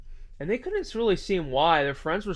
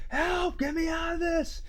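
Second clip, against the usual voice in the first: imitating a friend screaming in pain, much higher; mock scream for help; 11 semitones higher, 3 dB quieter than usual. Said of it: "his friend's screaming".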